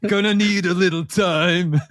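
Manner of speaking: slow, drawn-out mock singing voice; slow it right down, hammed-up heartbreak; a self-pitying hero, drawn out slowly; mock brooding ballad line. Mock dramatic moment.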